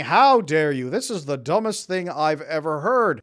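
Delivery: loud, indignant outburst, the second line slow; angry retort. Retold or imitated. Angry creators attacking the coach's video, loud and outraged. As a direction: blast the critic with outrage. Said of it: "really angry ... saying".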